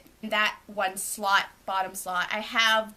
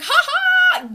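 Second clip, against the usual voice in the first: sarcastic fake laugh; 12 dB louder than usual. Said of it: "And I was like".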